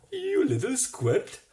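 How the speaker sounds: high, loud menacing beast; menacing anteater. A narrator's reading. Fattened anteater threatening Roy, high and loud menace. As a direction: threaten him like a hungry monster.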